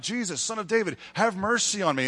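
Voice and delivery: calling out. Acted. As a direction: imitate a beggar calling out for mercy. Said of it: "he starts calling out".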